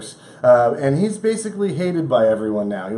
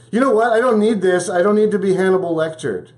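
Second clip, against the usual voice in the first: high, raised-voice protest, easing off; mock indignant suspect; 5 semitones higher, 3 dB louder than usual. Ex-cop stopping his interrogation, mock indignant. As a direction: act fed up and refuse the questioning.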